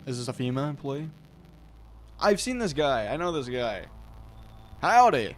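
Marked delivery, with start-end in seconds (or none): 4.8-5.3 loud high drawled howdy; cowboy howdy. Acted. Visitor at the door greeting the homeowner, cocky Southern howdy.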